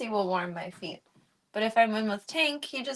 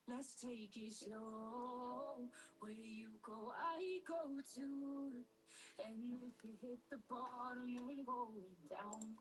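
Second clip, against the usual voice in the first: slow, hushed late-night radio voice; low slow DJ; 3 semitones higher, 23 dB quieter, slower than usual. Shown as a demonstration. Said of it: "Low and slow D J story time hour".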